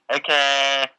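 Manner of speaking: usual speaking voice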